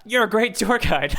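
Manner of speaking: laughing through mock praise, high; sarcastic praise. Real, not acted. Podcast host mocks his co-host's answer, laughing. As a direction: tease him, then crack up. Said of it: "Just kidding".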